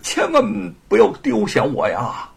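put-on earnest old man pleading to join; lightly voiced old veteran. Old veteran begs to join the fight against invaders, lightly acted plea. Old father-in-law character speaks.

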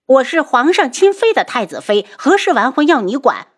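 high, loud furious yell; roaring. Acted. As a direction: bellow it in her face. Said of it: "扬着脸大吼". (扬着脸大吼)

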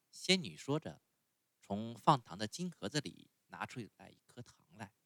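ordinary delivery

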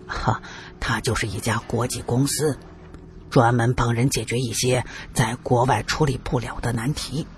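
low, measured, guarded explanation; cautious. A narrator's reading. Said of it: "一脸的谨慎地说".

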